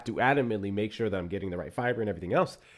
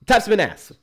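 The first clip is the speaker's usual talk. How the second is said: louder; 11 semitones higher, 10 dB louder than usual. Real, not acted. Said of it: "repeated louder".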